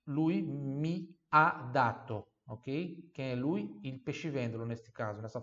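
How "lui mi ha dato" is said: slow, careful teacher model; slow model phrase. Shown as a demonstration. Teacher slowing down so students can repeat along. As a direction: spell it out slowly for the learner. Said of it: "eu falo".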